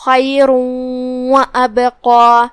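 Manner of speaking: خیر و ابقا with the nun merged into the waw, nasalised and doubled; slow recitation of خیر و ابقا with ghunna; nasal merge demo. Shown as a demonstration. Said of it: "تنوین کو واو میں داخل کر کے غنہ کے ساتھ".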